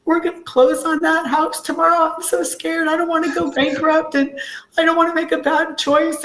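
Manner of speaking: high, upset voice recalling crying; mild tearful retelling to her husband; retold tearful fear. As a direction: replay the scared, teary plea with a lift in pitch. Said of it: "But I was bawling my eyes out".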